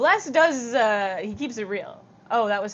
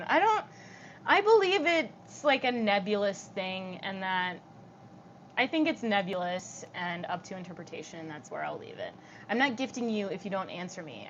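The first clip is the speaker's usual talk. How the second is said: ordinary delivery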